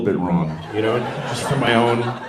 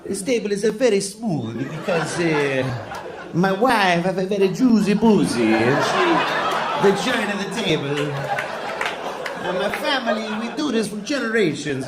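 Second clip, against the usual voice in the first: much higher hammed-up Italian accent; Italian table maker boasting about his family; Italian craftsman accent; 7 semitones higher than usual. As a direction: brag about the table like an old Italian woodworker. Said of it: "nice old Italian table".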